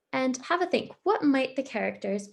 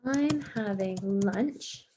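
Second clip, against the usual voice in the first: dramatic; much slower than usual. Shown as a demonstration. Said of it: "quite exciting or dramatic".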